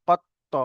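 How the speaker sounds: কত্ত with the ط closed, then popped open; qalqalah bounce. Shown as a demonstration. Teacher showing qalqalah on ত, কত্ত with a bouncing t. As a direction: stop on the heavy t of কত্ত and release a short echo. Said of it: "উচ্চারণটা হবে".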